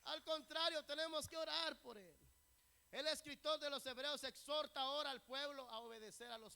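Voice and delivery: loud, high-pitched sermon delivery; full-force preaching. Real, not acted. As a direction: preach it out to the congregation. (predicación a toda voz)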